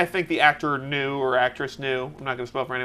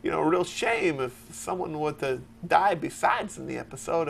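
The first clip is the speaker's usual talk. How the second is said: Christopher Walken impression; 8 dB quieter than usual. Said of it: "Christopher Walken".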